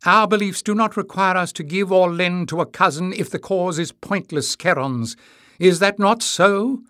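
high, loud rallying plea; impassioned appeal. A narrator's reading. Tyrrell appealing to her silent people, impassioned appeal. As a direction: raise the voice to sway the crowd. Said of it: "she appealed to the silent people".

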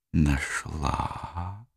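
soft, sweet and languid; sultry whisper. Acted. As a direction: breathe it out seductively. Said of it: "едва слышно, сладко и томно прошептала Сабрина". (сладкий томный шёпот)

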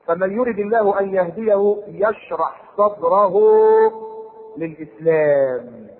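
very slow chanted verse; Quranic recitation. Acted. Preacher reciting to congregation, slow chant. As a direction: stretch the last word out.

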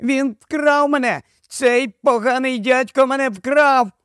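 put-on high piping little boy; very high child's voice; thin birdlike call. A narrator's reading. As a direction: cry it high as an accusing child. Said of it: "майже по-пташиному гукав малюк".

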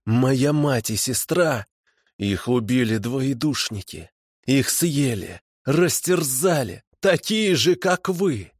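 high, drawn-out, bitter tirade; anguished fury. A narrator's reading. Man blames captors' kind for his family's death, anguished rage. Bereaved fanatic rants at captors.

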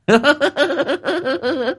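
high leering cackle; lecherous giggle, way up high; lewd laugh. Acted. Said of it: "cười dâm tiện".

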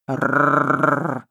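low rolling rrr growl of a huge dog; menacing growl. A narrator's reading. Narrator voices the beast's growl, low and menacing. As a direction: let a threatening growl roll out. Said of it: "услышала я грозное рычание".

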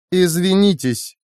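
high, slow icy command; cold. A narrator's reading. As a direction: cut in coldly, stretching the word. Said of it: "холодно перебила Лика".

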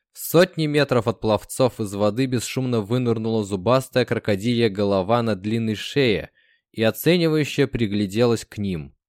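ordinary delivery, nothing marked

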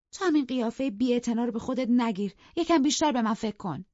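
high, loud reproach; angry scolding. Acted. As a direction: snap at the servant. Wife scolding the servant. (سرزنش تند و بلند)

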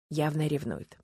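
ordinary delivery, nothing marked

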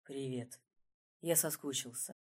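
soft, low whisper. A narrator's reading. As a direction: breathe it against her lips. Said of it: "шепнул в губы".

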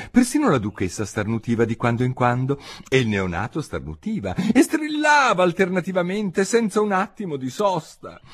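ordinary delivery, nothing marked

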